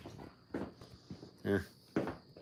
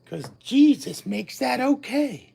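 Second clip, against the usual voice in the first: mild preacher; 10 semitones higher, 4 dB louder than usual. Retold or imitated. Preacher-style sing-song.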